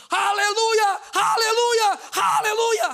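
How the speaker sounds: high, loud victory shout; teriakan haleluya. Retold or imitated. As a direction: shout a triumphant hallelujah at full force. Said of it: "Saya teriak". (berteriak lantang penuh kemenangan)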